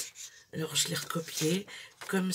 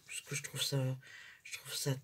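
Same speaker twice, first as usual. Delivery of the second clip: whispered; 7 dB quieter than usual. Real, not acted. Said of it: "hushed admiration".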